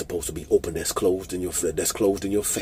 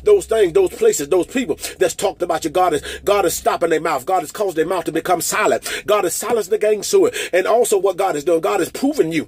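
higher, driving declaration, lines rushing faster toward the end; fervent preaching; 12 semitones higher, 7 dB louder than usual. Acted. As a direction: preach at full force, calling them out of the pain. Fervent declaration over congregation.

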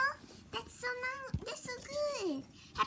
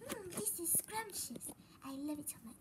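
whispering; 4 semitones lower, 9 dB quieter than usual. Pretend. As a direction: whisper it as you taste. Customer tasting food, quietly.

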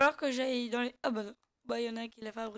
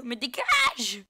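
loud, drawn-out yell; exasperated shout; 8 dB louder, much slower than usual. Real, not acted. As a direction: stretch the last word into a yell. Orders an opponent away in game. (cri exaspéré qui traîne)

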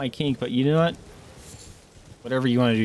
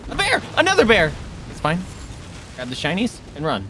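ordinary talk

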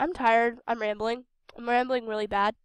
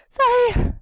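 squeaky sorry; 13 semitones higher, 12 dB louder than usual. Real, not acted. Squeaky apology after coughing.

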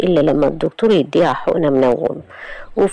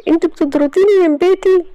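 put-on higher, distressed young woman; Iman's voice; 14 semitones higher than usual. Iman insisting to her aunt she never left home. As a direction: protest your innocence, voice pitched up.

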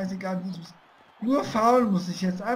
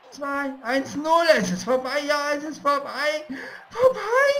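rising to a high shriek; shrieking jackpot winner; 5 semitones higher, 5 dB louder than usual. Real, not acted. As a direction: count down then shriek. Final countdown, victory. (kreischender Sieger)

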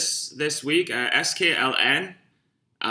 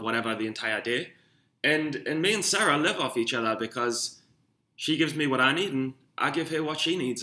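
ordinary talk, nothing marked

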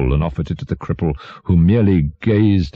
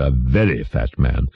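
usual speaking voice

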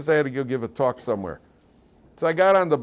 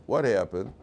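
usual speaking voice

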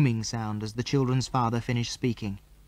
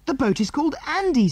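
high, thrilled squeal of the name; little girl squealing with excitement; girlish squeal; 13 semitones higher than usual. Acted. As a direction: squeal it in delight. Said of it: "squealed Jill".

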